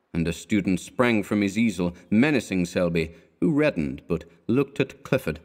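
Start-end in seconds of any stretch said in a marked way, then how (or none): none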